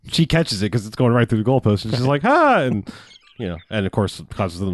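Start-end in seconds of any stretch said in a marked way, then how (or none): 2.2-2.6 high startled mock shriek. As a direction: yelp as the ball hits you. Cheerleader catching the ball.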